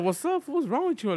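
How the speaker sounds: high, concerned hello; mock concerned greeting. Greeting a coworker, high and concerned. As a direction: ask what's wrong in a high voice. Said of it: "I was like".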